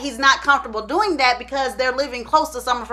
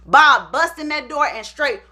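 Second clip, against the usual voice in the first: usual speaking voice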